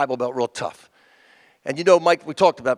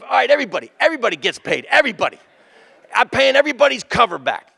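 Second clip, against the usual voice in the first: high, raised announcement to the room; Mike announcing; 7 semitones higher, faster than usual. Retold or imitated. Mike telling the crowd he's refunding covers, raised and high. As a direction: hand out refunds like a host.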